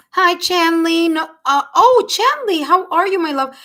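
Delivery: high, loud, effusive hello; delighted greeting. Real, not acted. Reader greets a loved regular in the chat, delighted greeting. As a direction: burst out as if a dear friend walked in. Greets a known viewer joining.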